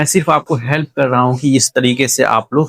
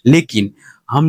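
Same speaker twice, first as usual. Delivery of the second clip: normal talking voice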